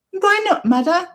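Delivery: high, loud hurt question; hurt son. Pretend. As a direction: ask like a wounded child.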